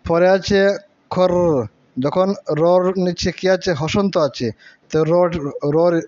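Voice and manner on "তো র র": র held long and slow; drawn-out r. Shown as a demonstration. Teacher holding র long for pupils. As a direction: stretch the র sound. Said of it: "রটিকে আমরা একটু বেশি সময় ধরে রাখবো".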